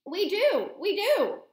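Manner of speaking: high, loud distressed cry; cried out. A narrator's reading. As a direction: blurt it out in alarm. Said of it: "cried Mister Jenkins".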